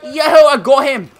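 very high, loud gamer yells; excited shouting. Real, not acted. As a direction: shout with the thrill of the hit. Finding and sniping enemies.

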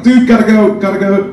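urgent exit line in an exaggerated American accent. Guest telling his host he must leave, mock American accent. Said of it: "I didn't say it in an American accent".

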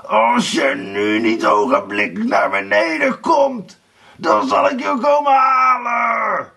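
villain's roar rising to a long yell; thunderous shouting. A narrator's reading. Blauwbaard threatens to fetch Erika, roaring. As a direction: bellow until the walls shake. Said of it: "begon Blauwbaard zo hard te schreeuwen dat het kasteel ervan trilde".

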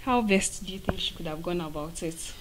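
ordinary delivery

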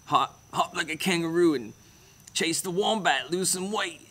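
high-pitched, hammed-up Aussie voice; mock Australian accent. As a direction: push the put-on Australian accent, high and jokey. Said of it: "stop doing accents".

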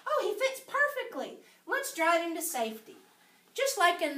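teacher voice. Acted. Teacher to young children.

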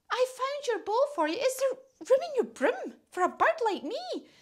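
high, dainty bird voice; polite little request in a high voice. A narrator's reading. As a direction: ask very politely, pitched right up. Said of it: "very politely and said".

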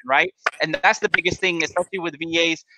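normal talking voice

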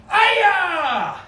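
high, loud karate shout; karate yell. Shown as a demonstration. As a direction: yell it with the whole class. Karate class yell after song.

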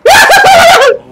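high, loud hammed-up scary cackle; mock monster cackle. Scaring the game monsters back, hammed-up high loud cackle. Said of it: "Ahora yo espanto a los monstruos".

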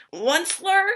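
high, complaining call of the name; querulous croak. Acted. Lorax calls to the Onceler, a querulous croak. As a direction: croak the name in a whiny cry. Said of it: "he cried with a querulous croak".

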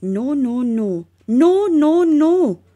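rising chorus of refusal; from firm to a loud protest; animals protesting. A narrator's reading. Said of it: "all of the animals ... said".

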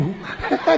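quick light chuckle; short laugh. Real, not acted. Preacher chuckles to the congregation, quick light laugh. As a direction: laugh at the greedy pilgrim.

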